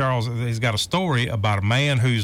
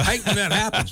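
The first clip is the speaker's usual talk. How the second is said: laughing; 8 semitones higher than usual. Real, not acted. Joking reply to the septic joke.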